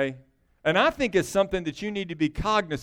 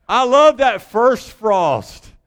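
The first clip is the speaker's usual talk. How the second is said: relishing; 5 semitones higher, 8 dB louder, much slower than usual. Real, not acted. Preacher relishing the first frost.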